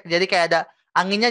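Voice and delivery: ordinary talk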